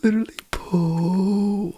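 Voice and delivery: long, high drawn-out boo; mock booing. Real, not acted.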